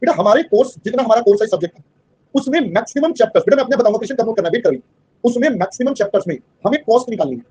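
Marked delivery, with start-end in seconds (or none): none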